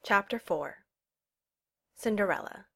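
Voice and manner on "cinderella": usual speaking voice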